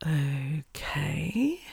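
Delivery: drawn-out, whispery, rising okay; whispered okay that curls up at the end; imitated director's okay. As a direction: mimic her in a low, breathy whisper. Director voiced over the God speaker.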